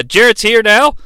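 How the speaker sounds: ordinary talk, nothing marked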